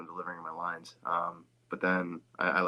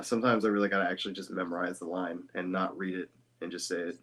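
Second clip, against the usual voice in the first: ordinary delivery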